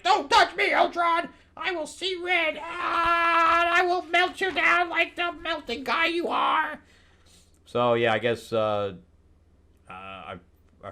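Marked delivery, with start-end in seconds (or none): 0.0-6.8 much higher, screeching melodramatic yell; hysterical heroine. Retold or imitated. Firestar screaming at Ultron in her flashback, high and hammy. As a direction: shriek it in over-the-top fury. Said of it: "and she's like".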